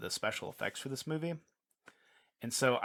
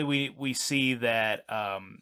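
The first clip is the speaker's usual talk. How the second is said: usual speaking voice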